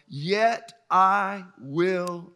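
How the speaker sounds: yet I will stretched very slow and loud; slow emphatic build. Acted. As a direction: drag out yet I will, then land it. Preacher drawing out scripture climax.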